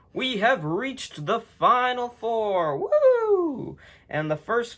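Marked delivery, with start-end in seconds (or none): 0.1-2.8 high, slow, with a laugh; excited announcement. Real, not acted. Excited announcing final four. 2.8-3.8 high excited whoop; cheer. Real, not acted.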